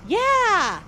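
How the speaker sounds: high, loud burst of enthusiasm; real excited cheer. Real, not acted. Streamer welcoming a meet-up at the convention, excited. Eagerly inviting viewer to meet up.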